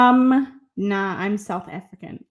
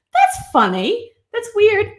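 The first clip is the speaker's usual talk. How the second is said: loud, high-pitched mock reaction; mimicked TikTok catchphrase; 11 semitones higher, 9 dB louder, slower than usual. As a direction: pitch it up and loud like the viral clip.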